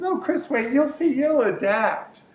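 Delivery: a hint of the doctor's coaxing; gently quoting her. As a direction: soothe him like a kind doctor. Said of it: "She says".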